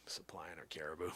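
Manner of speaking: very soft, low whisper; barely audible muttered comment; hushed aside. Real, not acted. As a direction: slip it in under your breath. Sly aside after caribou count.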